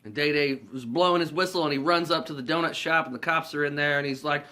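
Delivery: ordinary talk, nothing marked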